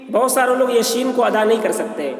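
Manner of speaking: ordinary talk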